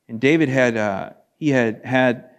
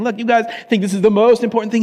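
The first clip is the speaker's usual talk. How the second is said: high, quick, louder mock exasperation; retold rebuke; 8 semitones higher, 5 dB louder, faster than usual. Apostle taking on the church in Corinth, mock exasperated. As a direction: push it out fast and pitched up. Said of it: "And he's saying".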